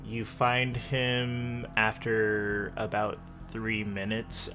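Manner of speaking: usual speaking voice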